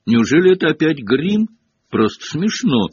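high, loud burst of disbelief; exasperated exclamation. A narrator's reading. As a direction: cry out, fed up with the nonsense. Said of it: "воскликнула Гермиона". (громко, с раздражённым возмущением)

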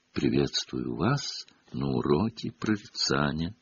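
low, hushed, airy seer voice; soft mystic seer. A narrator's reading. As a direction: murmur like a dreamy prophetess. Trelawney greets the class.

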